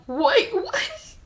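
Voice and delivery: high-pitched, loud double take; shocked disbelief. Retold or imitated. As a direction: squeal it in mock shock. Reacts to everyone having died.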